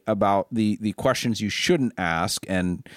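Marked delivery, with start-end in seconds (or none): none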